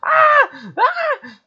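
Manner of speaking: two very high screams; frightened scream. Real, not acted. Player scared by a game threat, screaming. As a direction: shriek twice, high and sudden.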